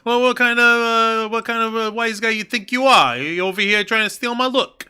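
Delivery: high tough-guy mobster voice; sputtering offended wiseguy; Tony D'Angelo. Retold or imitated. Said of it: "Tony D'Angelo will look at it and he'll be like".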